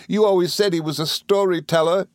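high, tearful reproach; Dora, sobbing. A narrator's reading. Wife contradicting husband, high acted sob. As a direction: wail the complaint in a wife's high voice. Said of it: "sobbed Dora".

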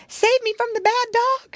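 very high plea for rescue; high-pitched, urgent appeal; frightened cry. A narrator's reading. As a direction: cry out high like a chased lady cat. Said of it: "she cried".

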